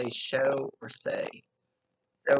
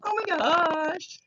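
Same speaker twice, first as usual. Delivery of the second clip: put-on very high, loud, slow valley girl squeal; 6 dB louder, much slower than usual. Medium voicing the excited spirit as a valley girl. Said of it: "She's kinda like".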